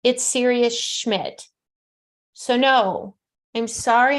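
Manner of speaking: usual speaking voice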